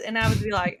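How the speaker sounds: usual speaking voice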